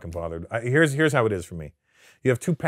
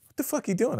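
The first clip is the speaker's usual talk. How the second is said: confused; 13 semitones higher than usual. Retold or imitated. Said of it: "And he goes".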